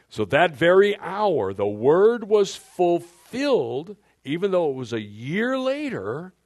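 loud, slow, high preaching voice. Acted. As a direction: proclaim it like a preacher.

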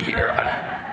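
very high-pitched bailiff cackle; mocking laugh. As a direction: cackle cruelly at the accused as you taunt. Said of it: "he starts laughing".

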